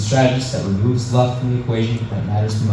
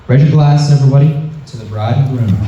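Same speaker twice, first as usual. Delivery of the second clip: wedding toast speech; 5 dB louder than usual. Acted. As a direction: raise a glass, rousing toast.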